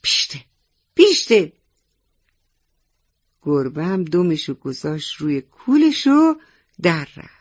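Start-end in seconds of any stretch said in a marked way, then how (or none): 0.0-1.5 sharp shoo at a cat, louder on the repeat. A narrator's reading. Grandfather steps forward to chase off the cat, loud shooing. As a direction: shoo it off, building to a high, loud burst. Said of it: "با صدای بلند گفت".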